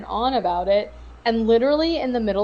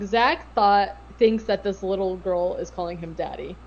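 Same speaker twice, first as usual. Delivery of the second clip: normal talking voice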